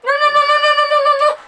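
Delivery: high, frantic pleading; panicked no's. Real, not acted. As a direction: beg it to stop in panic. Fly near her, panicking.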